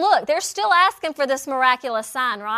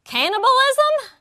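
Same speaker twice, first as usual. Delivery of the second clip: high, loud startled "Woo, cannibalism?"; shocked disbelief; 10 semitones higher, 7 dB louder, slower than usual. Retold or imitated. Preacher voicing the shocked crowd, high, loud disbelief. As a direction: yelp it out in mock shock. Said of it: "their mind is going wacko".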